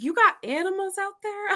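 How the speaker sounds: put-on high, amazed city-girl question; mock shocked city friend. City friend hearing about the woods, high mock shock. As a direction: ask like the woods are another planet. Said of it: "She's like".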